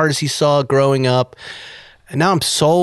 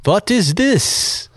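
put-on slow, higher German-accented question; 8 semitones higher, much slower than usual. German stranger asking about the car, German accent. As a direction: drawl it out like a curious German tourist. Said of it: "German guy walks up".

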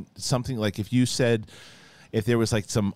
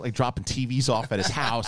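ordinary talk, nothing marked

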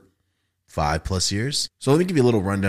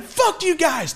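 mock yell; much slower than usual. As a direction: shout it back at friends, much higher. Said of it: "As I'm like".

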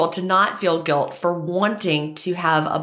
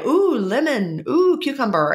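excited brain voice; 8 semitones higher than usual. Acted. Brain voiced craving flavors.

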